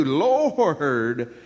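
long, wailed LORD; exaggerated prophet's lament; Habakkuk. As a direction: draw the one word out as a dismayed plea. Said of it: "I bet that's the way he said it too".

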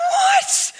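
high laughing shout of disbelief; laughing startled cry; shocked shout. Retold or imitated. Shocked by the noise.

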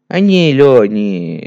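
sudden loud blurt; loud outburst. Real, not acted. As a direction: burst out loud, flustered.

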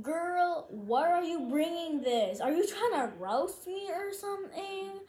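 high, loud sassy confrontation; exasperated challenge. Retold or imitated. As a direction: call her out, stretched and indignant. Acted exasperated challenge to her friend.

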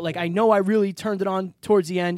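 normal talking voice

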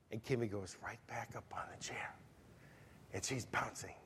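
whispered, conspiratorial aside. A narrator's reading. Preacher telling the congregation the toddler climbs back up, whispering. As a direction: whisper it like a secret the kid is getting away with. Suspense in retold story.

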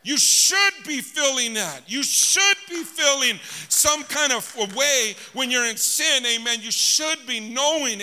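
slow, loud sermon climax; full-force preaching. Acted. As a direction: bear down on them, drawn out.

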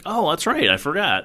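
high, loud sudden recall; quoted self, hammed-up surprise at forgetting; mock realization. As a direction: play it as if it just dawned on you.

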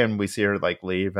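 ordinary talk

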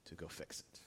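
hushed whisper. Acted. Jesus telling his disciples to fix it themselves, whispered. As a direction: breathe it out as a secret.

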